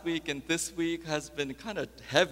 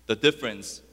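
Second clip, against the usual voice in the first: ordinary talk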